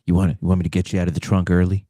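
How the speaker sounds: low, fast sultry come-on; sex line voice. Acted. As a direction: purr a teasing offer. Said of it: "trying to get with me over the phone".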